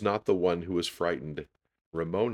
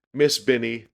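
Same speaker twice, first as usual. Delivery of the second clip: high, loud, urgent call; crying out; 7 semitones higher, 8 dB louder than usual. A narrator's reading. Teased girl running to her teacher, crying out. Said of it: "she cried".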